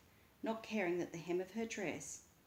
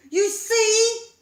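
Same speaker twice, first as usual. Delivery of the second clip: much higher, much louder; shouted vindication; 21 dB louder than usual. A narrator's reading. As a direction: call it across the yard. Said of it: "voice called out to her".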